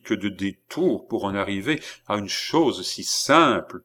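ordinary delivery

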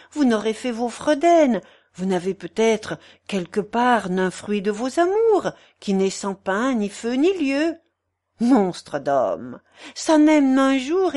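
voluble, animated busybody; scolding portress. Acted. Portress chiding her sick lodger about old loves, scolding. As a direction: chide him with lively pitch swings.

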